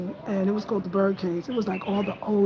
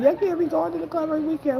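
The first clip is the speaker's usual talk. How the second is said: mom mocking; 8 semitones higher, faster than usual. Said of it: "my mom was like".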